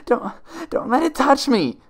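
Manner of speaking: high, loud, panicked cry; terrified plea. Acted. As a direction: recoil in terror, begging it to stay away. Terrified pony pleads with shadows.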